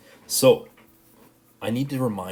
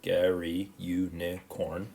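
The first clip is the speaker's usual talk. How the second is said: slow, each syllable spaced out; much slower than usual. Shown as a demonstration. Teacher counting syllables for students, slow and spaced. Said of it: "five syllables in the first".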